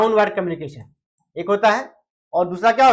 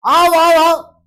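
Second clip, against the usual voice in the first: आओ आओ आओ shouted fast, much higher and louder; urgent call; 8 semitones higher, 7 dB louder, much slower than usual. Shown as a demonstration. Calling a friend to run for the train, shouting. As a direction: hurry them, the train is leaving. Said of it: "speed आपने बढ़ा दिया".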